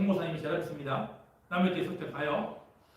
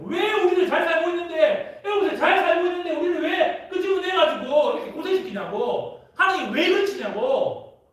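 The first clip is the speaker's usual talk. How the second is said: whining complaint; 10 semitones higher, 8 dB louder than usual. Acted. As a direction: moan at God for dragging them out. Complaining tears of Israelites. (높고 크게 불평하며 우는 소리)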